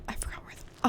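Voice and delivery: unvoiced whisper to self. Real, not acted. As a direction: whisper it under your breath. Muttering to self while searching.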